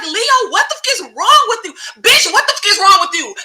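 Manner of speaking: high-pitched imitation of outrage; mock outraged yelling. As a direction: berate him in a hammed-up high voice. Acts Lucy yelling at Leo.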